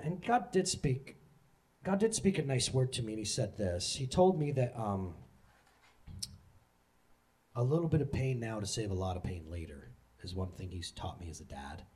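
normal talking voice

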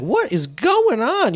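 high, drawn-out baffled question; acted bewilderment. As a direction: stretch it out in mock disbelief. Acting out inner bewilderment.

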